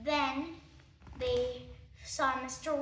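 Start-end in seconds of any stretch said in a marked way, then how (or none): none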